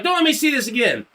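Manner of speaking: lightly acted teacher's warning; retold teacher's scolding. Teacher scolds him in the story.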